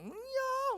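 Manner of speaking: imitated piercing shriek, pitched far up; mock high shriek. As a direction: shriek it up at the top of the range like the pastor. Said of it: "삼 옥타브 파 아십니까?".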